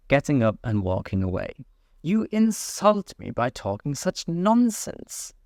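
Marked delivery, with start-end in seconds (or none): 2.0-5.3 offended mouse. A narrator's reading.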